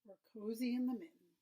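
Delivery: usual speaking voice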